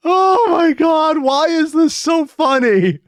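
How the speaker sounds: high, loud, drawn-out cry of delight; hysterical amusement. Real, not acted. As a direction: wail it, helpless with amusement. Cracking up at the gag.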